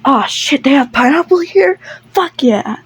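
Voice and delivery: sudden eager, rising delight; acted excitement. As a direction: perk right up at a happy discovery. [name] delighted to spot pineapple.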